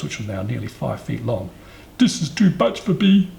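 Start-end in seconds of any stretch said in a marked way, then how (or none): 2.0-3.3 high, loud, blocked-nose cry; through his nose. A narrator's reading. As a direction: protest as a little elephant with nose clamped. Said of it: "he said through his nose".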